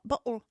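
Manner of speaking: BOTTLE as "bo'ul", no T; glottal-stopped BOTTLE. Shown as a demonstration. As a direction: demonstrate BOTTLE with a throat catch for the T. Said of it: "that's called a glottal stop".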